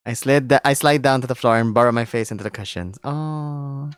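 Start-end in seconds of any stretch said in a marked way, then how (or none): none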